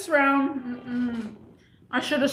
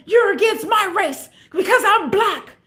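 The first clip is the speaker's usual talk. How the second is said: high, loud mock-outraged customer; going crazy; 8 semitones higher, 5 dB louder than usual. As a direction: accuse loudly, imitating his outburst. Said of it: "he just started going crazy".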